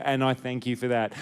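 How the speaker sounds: ordinary talk, nothing marked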